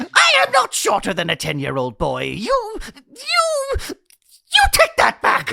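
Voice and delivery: loud, rising into a near-crying rant; furious shout. Acted. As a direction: explode with wounded pride. Furious outburst at the insult.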